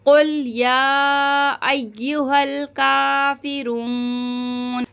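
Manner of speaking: very slow, drawn-out recitation of अय्युहल काफिरून. Shown as a demonstration. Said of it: "तीन अलिफ की मिकदार". (धीमी तिलावत)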